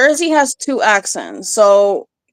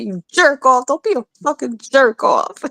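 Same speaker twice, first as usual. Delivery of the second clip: Jersey Italian; 5 semitones higher than usual. Retold or imitated. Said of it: "a thing that Italians say in Jersey".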